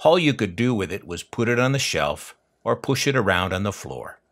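ordinary delivery

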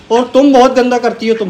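high, loud playful scolding of the bird; mock scolding. Real, not acted. (चिढ़ाते हुए डांटना)

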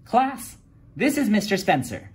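high, bright teacher voice; teacher introducing the mechanic to her class; cheerful teacher. A narrator's reading. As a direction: call the class to attention happily. Miss Fizzle introduces the mechanic.

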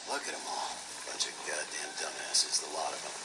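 disgusted mutter. Acted. Muttering at the dead.

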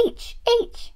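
high doll answer; Peach. Pretend. Doll answering the teacher, high-voiced pupil. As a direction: pipe it up as a little toy pupil.

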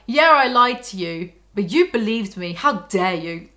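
hammed-up smug boyfriend; mocking Edward's guilt-tripping, slow and loud. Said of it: "The way he's like".

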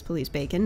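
"police bacon" with a clear "bacon", said like the food; misreading baton as bacon. Shown as a demonstration. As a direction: say "bacon" in place of baton, deliberately. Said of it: "which I choose to read as".

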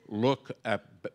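ordinary talk

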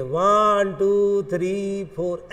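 slow, drawn-out counting; step-by-step count. Shown as a demonstration. Teacher showing students how to go in order, slow measured count. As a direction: stretch each number out in an even series. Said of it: "ऐसे चलिए".

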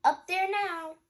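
high, loud order; loud command. Acted. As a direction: send her up with energy. Ryder giving order.

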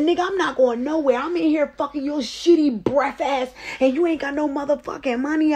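high-pitched, heated retold rant; angry yelling. Unpaid woman refusing to leave, angry yelling. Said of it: "I'm swinging the keys around and I'm yelling".

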